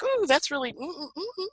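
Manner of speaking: high, breathy-free mock swoon trailing into hums; hammed-up gushing admirer voice. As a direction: coo over it like a smitten fan, then melt into hums. Mock swoon at out-of-bounds planet.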